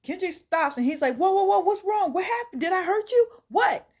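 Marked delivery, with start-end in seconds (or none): 1.2-3.9 high, loud mock panic; storyteller's frantic, high-pitched alarm; acted panic. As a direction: blurt alarmed questions as a panicking man. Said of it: "Kendrick is panicking".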